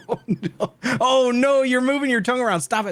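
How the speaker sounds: high, urgent begging; distressed pleading. Real, not acted. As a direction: plead with him to stop. Friend moving tongue in hot mouth.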